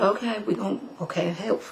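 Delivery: laughing it off softly; half-laughing, trailing off quietly; nervous laugh. Retold or imitated. Nervous reply to Reverend's defiance.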